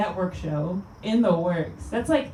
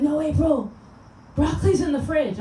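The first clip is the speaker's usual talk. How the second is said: voicing dad; 7 semitones higher, 4 dB louder than usual. Dad voiced in the story.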